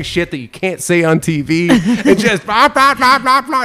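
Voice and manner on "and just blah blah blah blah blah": high, loud babbling rush; excited riffing. Retold or imitated. Riffing wildly on set to make Guy laugh, high excited babble. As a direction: rattle off nonsense gleefully.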